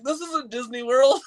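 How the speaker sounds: high-pitched mock complaint; playful retelling of an annoyed friend; imitated exasperation. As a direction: protest it high, half laughing. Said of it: "And he'd be like".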